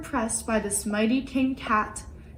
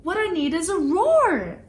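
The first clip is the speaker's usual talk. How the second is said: excited mouse; 6 semitones higher than usual. Acted. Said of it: "he said".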